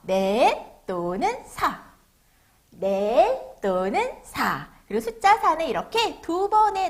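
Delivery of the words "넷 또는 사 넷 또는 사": very slow, drawn-out words; read-along model. Shown as a demonstration. Teacher has children read the number four along, very slow read-along model. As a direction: stretch each word, lifting the non-final ones. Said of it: "같이 따라 읽어볼게요".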